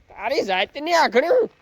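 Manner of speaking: high, drawn-out scold rising at the end; scolding call. Real, not acted. Farmer to his cattle, scolding call. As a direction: yell crossly at a stubborn animal.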